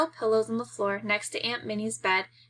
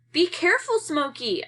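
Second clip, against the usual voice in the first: higher, louder warning call; shout; 7 semitones higher, 3 dB louder than usual. Acted. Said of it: "I shout".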